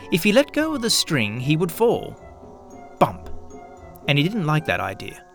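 usual speaking voice

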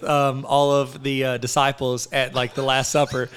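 ordinary talk

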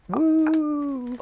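high wailing "woo" howl; werewolf howl. Pretend. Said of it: "was that an impression of a werewolf".

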